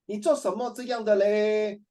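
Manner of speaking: drawn-out, high Singlish whine of dismay; exasperated Singaporean. Acted. Teacher mimics Singaporeans mixing Malay and Chinese, exasperated.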